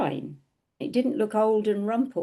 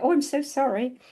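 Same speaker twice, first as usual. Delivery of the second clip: higher, retold sheepish apology; mildly embarrassed; 7 semitones higher than usual. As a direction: apologise as if just told off. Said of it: "I was embarrassed".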